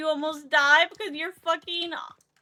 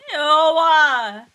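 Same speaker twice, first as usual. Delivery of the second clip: loud grossed-out yell; disgusted shout; 15 dB louder than usual. Real, not acted. Disgusted reaction.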